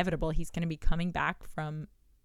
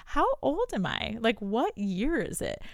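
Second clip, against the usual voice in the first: mock confusion; 6 semitones higher, 7 dB louder than usual. Confused about own age, laughing.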